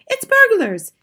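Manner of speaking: very high, loud alarm; mother's urgent, high-pitched cry; alarmed hiss. A narrator's reading. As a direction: raise a panicked burglar alert. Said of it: "hissed the mother".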